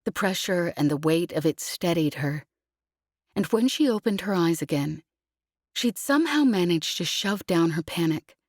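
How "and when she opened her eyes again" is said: usual speaking voice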